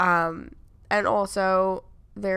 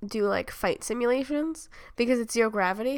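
usual speaking voice

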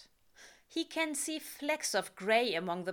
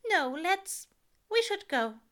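higher, louder, hurried angel; nervous angel pushing to leave, pitched up; Aziraphale voice; 10 semitones higher, 6 dB louder than usual. A narrator's reading. Angel urging Crowley to leave, nervous and higher.

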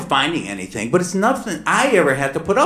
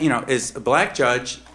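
ordinary delivery

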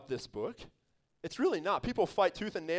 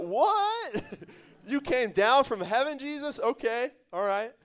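mock disbelief, much higher, half-laughing; incredulous bystander; 5 semitones higher, 3 dB louder, slower than usual. Crowd member hearing Jesus, laughing mock disbelief. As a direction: play a baffled listener doubting Jesus. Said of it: "People should have been like".

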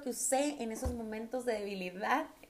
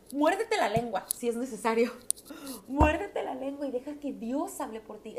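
emphatic command; 3 semitones higher, 6 dB louder than usual. Acted. Commanding restraint.

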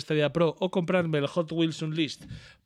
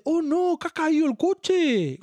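put-on high, louder childish wail that the car fell; toddler dismay; 14 semitones higher, 6 dB louder, slower than usual. Father imitating his son upset the car fell, high childish wail. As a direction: wail it like a disappointed small kid. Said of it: "y dice".

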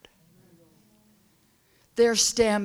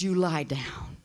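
soft whisper; 4 semitones lower, 5 dB quieter than usual. Acted. God telling the flock to rest, whispered. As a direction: breathe it gently like a shepherd.

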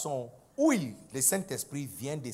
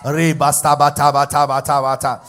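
fast babbling prayer in tongues, growing louder; mock speaking in tongues; 3 semitones lower, 13 dB louder than usual. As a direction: rattle off tongues, building to a loud roll. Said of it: "c'est parti". (parler en langues, de plus en plus fort)

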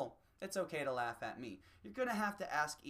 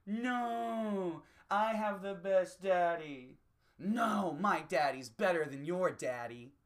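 put-on higher, louder kid whine, slow start; mock toddlers fighting about daddies; childish bickering; 4 semitones higher, 7 dB louder, slower than usual. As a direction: brag like a spoiled child. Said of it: "Just going".

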